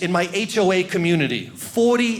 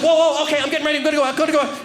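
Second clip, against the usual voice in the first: excited dog; 7 semitones higher, 3 dB louder, much faster than usual. Retold or imitated. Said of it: "he's like".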